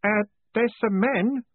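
put-on high, slow, nervous young voice; timid, high-pitched lad; uncertain boy. A narrator's reading. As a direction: offer the news hesitantly, with an uneasy grin. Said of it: "grinning uncertainly".

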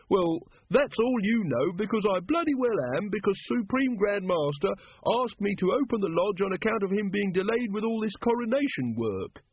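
high-pitched, puffed-up self-importance; haughty. A narrator's reading. Brother Watchtower defending his title to the brethren, haughty and pitched high. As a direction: insist loftily on your rank. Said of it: "said Brother Watchtower haughtily".

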